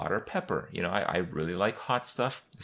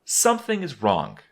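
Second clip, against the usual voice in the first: alarmed; 6 semitones higher, 6 dB louder than usual. Retold or imitated. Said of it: "It was like".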